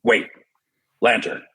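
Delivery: punchy, terse hero commands; one short direct word at a time; Batman. Shown as a demonstration. Actor gives superhero lines to the host, punchy and terse. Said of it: "It's punchy".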